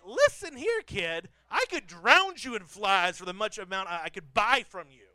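mock furious parent lecture; mock angry dad. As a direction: playfully threaten the ungrateful kid. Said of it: "And I was like".

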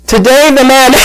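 high-pitched words collapsing into laughter; reenacted laughing fit. Preacher retelling his failed announcement, acted uncontrollable laughter. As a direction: start the announcement and burst out laughing. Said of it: "I am hollering and laughing".

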